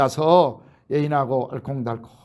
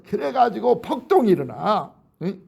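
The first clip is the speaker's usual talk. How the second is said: ordinary talk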